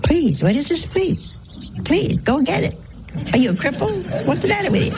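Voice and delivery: high-pitched mock indignation at politeness; mock Italian-family bickering. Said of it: "It's just the way we talk".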